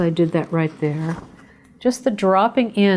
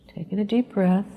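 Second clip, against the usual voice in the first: guided meditation. Acted. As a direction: ease the listeners into calm, breath by breath. Leads a short breathing meditation.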